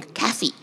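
soft lisped Kathy said as Kafi, T H as F; a small child saying Kathy as Kafi; toddler saying Kathy. Retold or imitated. Toddler greets the diner waitress, lisped Kathy as Kafi. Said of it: "two or something".

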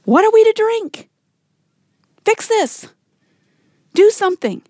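high-pitched, indignant mock complaint; grumbling demand. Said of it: "the people grumbled against Moses".

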